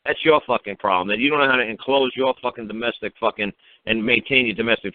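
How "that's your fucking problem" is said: usual speaking voice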